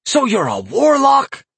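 high, slow, rising accusation; accusing yell. A narrator's reading. Farmer to the imp's owner, accusing yell. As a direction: hurl it as an outraged accusation. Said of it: "he yelled accusingly".

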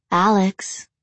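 lightly sultry, drawn-out name. Acted. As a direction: call his name seductively. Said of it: "came a sultry voice".

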